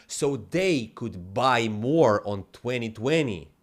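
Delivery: ordinary delivery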